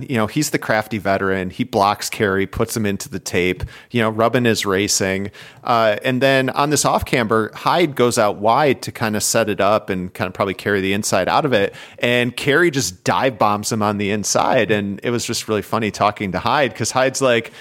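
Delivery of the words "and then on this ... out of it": ordinary delivery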